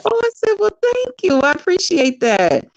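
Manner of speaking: high, coy thank-you; flattered. Retold or imitated. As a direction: act charmed and a little bashful, pitched well up. Retelling a flattered reply.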